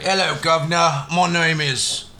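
put-on British detective accent; hammed-up Cockney-style British greeting, drawing out the name; Sherlock Holmes. Detective introducing himself to the officer, in a mock British accent. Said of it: "have to have a British accent".

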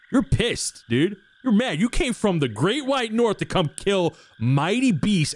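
rising, hyped-up pep talk; hyping up. Acted. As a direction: fire him up like a coach before the fight. GM hypes up furious character.

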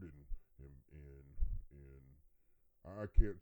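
slow stammer, words stuck on and; stuttering. Acted. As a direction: stumble over and, and, and, too flustered to go on. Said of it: "look, I'm stuttering now".

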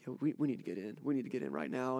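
low, hushed urgency of a worried granddad; scared granddad, understated. Retold or imitated. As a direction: quietly voice a grandfather hiding alarm. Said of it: "who I'd never seen scared in my life".

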